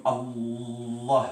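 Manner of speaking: Allah with the L held very long before release; drawn-out double L in Allah; retained consonant. Shown as a demonstration. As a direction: hold back on the L of Allah, then let go. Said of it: "ça, c'est la retenue".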